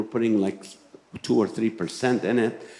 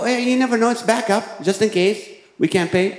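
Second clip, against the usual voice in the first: a high, defensive protest; 8 semitones higher than usual. Retold or imitated. Husband defends the watch to his doubting wife, high and defensive. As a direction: pitch it up to defend yourself.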